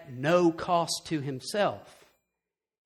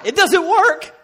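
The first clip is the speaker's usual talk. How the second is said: laughing emphatic; 10 semitones higher, 10 dB louder, slower than usual. Real, not acted. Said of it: "I can tell you as a guy".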